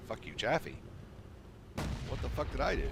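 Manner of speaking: outraged disbelief at a teammate; can't believe he said that; incredulous. Retold or imitated. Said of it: "And I'm like".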